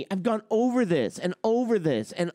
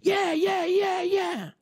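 mock eager agreement; 9 semitones higher, slower than usual. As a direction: cheer along like a crowd that agrees with everything. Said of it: "And everybody's like".

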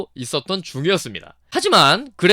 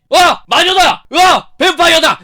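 high, loud hammed-up shouting; imitating a crazed outburst at the mother; mock shouting; 11 semitones higher, 9 dB louder, slower than usual. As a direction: yell it like a maniac. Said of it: "엄마한테 소리를 지르고".

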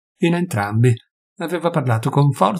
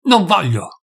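higher-pitched, indignant cry of refusal; angry cry; 6 semitones higher, slower than usual. A narrator's reading. Doctor refusing to listen to his host, crying out. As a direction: cry out the refusal, mildly raised. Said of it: "gridò".

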